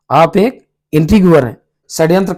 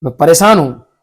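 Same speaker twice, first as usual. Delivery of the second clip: mocking tease; 4 dB louder than usual. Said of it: "वो मतलब उसकी चिढ़ बनाता है".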